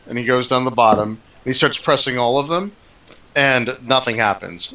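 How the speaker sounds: ordinary talk, nothing marked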